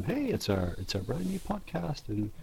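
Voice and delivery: a light hammed-up host intro; cheery podcast intro. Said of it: "I like how we've gone from".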